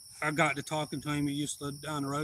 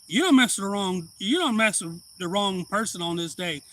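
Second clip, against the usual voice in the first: loud, higher, hammered warning; warning the man who insulted him; retold angry yell; 5 semitones higher, 6 dB louder than usual. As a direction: bark the warning with force. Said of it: "I'm like".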